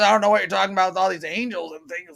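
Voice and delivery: lightly imitated Southern fisherman; a rough stab at his Southern accent. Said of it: "I can't even imitate his voice".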